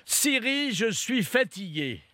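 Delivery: high-pitched call, then a clear request; talking to Siri. Real, not acted. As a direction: speak up so the phone understands. Addressing voice assistant Siri.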